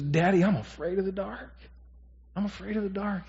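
a hint of a small boy pleading; scared little boy. Pastor voicing his four-year-old son at night, lightly childlike. Son's words voiced in story.

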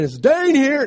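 high, loud mock call; teasing yell. Older son teasing his parents with a loud mock yell. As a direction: tease the parents by shouting it. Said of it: "They come in".

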